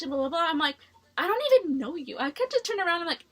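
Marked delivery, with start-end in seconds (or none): 1.2-2.2 higher, louder indignant comeback; indignant protest. Retold or imitated. Answering a classmate's attack behind her, indignant. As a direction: push back in affronted disbelief.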